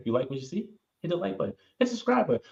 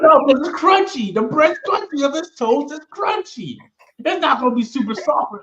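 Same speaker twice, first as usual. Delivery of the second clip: high protest; 12 semitones higher, 6 dB louder than usual. Real, not acted. Defending crunchy toast.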